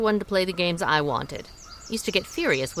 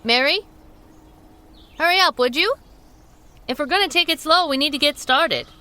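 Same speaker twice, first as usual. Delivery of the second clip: calling out; 7 semitones higher than usual. Acted. Sue calls to Mary across the field, raised voice. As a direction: call her name from a distance.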